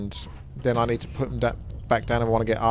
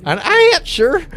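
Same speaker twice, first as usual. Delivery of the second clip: mock Texan accent; 11 dB louder, slower than usual. Shown as a demonstration. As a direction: say "An archer?" in a hammed-up Texas drawl. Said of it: "Do that accent you like so much".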